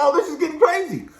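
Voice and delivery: high, strained voice of disbelief; amazed reaction. Retold or imitated. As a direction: sell how wild the fight got. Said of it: "I was like".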